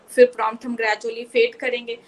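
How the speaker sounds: ordinary delivery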